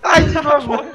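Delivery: panicking gamer. Real, not acted. Killed again in game.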